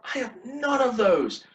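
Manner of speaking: high, loud burst of self-mockery; comic admission. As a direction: exclaim it way up high. Comic admission after listing dance requirements.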